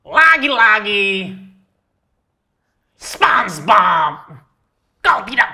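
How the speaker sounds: a hint of a cartoon voice; lightly voiced cartoon character.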